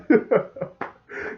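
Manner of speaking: loud, high burst of laughter; real amused laugh. Real, not acted. Amused by the retold scene.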